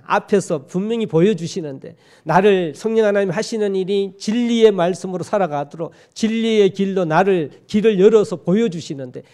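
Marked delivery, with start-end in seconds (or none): none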